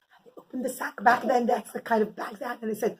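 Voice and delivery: usual speaking voice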